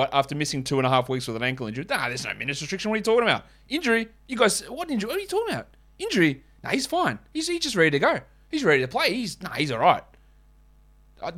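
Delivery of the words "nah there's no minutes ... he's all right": high, dismissive fake denial; mocking team spokesman. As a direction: play the Kings brushing off the injury, high and scoffing. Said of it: "mocking Kings' denial".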